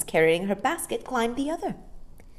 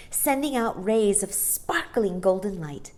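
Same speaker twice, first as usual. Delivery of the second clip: ordinary delivery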